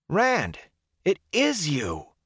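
high, drawn-out cry of recognition; amazed call. A narrator's reading. Mat spotting Rand in the crowd, amazed.

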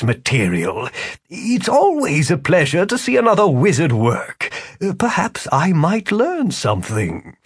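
a smug showman wizard, unhurried. Acted. Philip the wizard speaking.

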